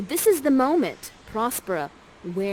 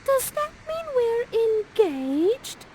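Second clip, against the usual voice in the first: put-on much higher, slow girlish question; hopeful Miranda; slower than usual. Miranda asking Ferdinand if they are engaged, high hopeful question. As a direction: ask it slowly, starry-eyed. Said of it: "And then Miranda says".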